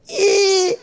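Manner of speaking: very high strained squeak; squeezed squeal. Retold or imitated. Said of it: "he'd make a sound".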